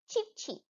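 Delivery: teacher chirping for the class; bird chirp imitation. Shown as a demonstration. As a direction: chirp it high and gently. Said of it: "Burung make".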